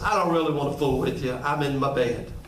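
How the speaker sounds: pitched low, quick dismissive mumble; grumpy sleepy brush-off. Acted. Neighbour in bed brushes off the knocking friend, low and fast. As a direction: wave him off from bed. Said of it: "and the other guy says".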